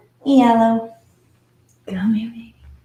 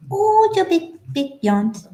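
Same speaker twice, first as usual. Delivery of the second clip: delighted coo; 7 semitones higher than usual. Real, not acted. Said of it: "want to say that too".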